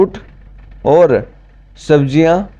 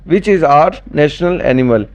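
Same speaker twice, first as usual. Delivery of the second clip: slow dictation; much slower than usual. Shown as a demonstration. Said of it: "question repeated slowly".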